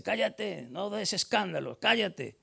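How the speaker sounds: soft, hissed scolding to be quiet; hushing. Retold or imitated. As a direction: shush him sternly but softly. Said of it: "le reprendían que se callase". (la multitud reprende al ciego, chistando en voz baja)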